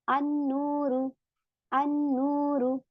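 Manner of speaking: अन्नूरु slow, with a doubled n and no l; silent lam. Shown as a demonstration. Teacher shows the students a sun letter. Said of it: "सीधा हमजा को नून में मिलाया".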